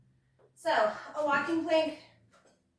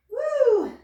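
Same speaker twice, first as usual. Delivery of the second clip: high, loud whoop; excited cheer; 10 semitones higher, 8 dB louder than usual. Real, not acted. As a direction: whoop to pump up the class. Coach cheering during push.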